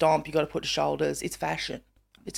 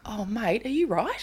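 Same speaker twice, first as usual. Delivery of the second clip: chiding oneself; 2 semitones higher, 6 dB quieter than usual. Retold or imitated. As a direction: ask it softly, baffled at yourself. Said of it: "listening to myself and I'm like".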